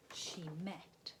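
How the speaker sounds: plain reading voice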